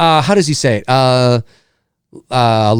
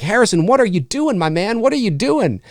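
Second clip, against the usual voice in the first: exasperated; 7 semitones higher than usual. Retold or imitated. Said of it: "it's like".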